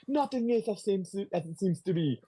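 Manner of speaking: high, theatrical villain voice; Mysterio. Retold or imitated.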